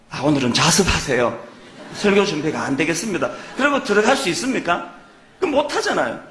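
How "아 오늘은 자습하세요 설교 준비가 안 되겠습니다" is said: pretend excuse to congregation. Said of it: "그렇다고 제가 오늘 여러분한테 와가지고".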